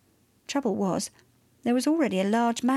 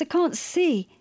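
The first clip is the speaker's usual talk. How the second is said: higher-pitched protest; mildly alarmed exclamation; crying out; 6 semitones higher than usual. A narrator's reading. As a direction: cry it out, voice lifted. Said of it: "cried Cassius".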